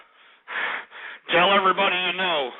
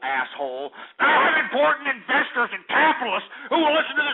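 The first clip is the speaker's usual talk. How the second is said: angry rant; 8 semitones higher than usual. Real, not acted. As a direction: rage at them. Sustained rant at caller.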